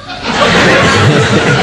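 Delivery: hearty laugh at the memory; real laugh. Real, not acted. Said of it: "that's funny".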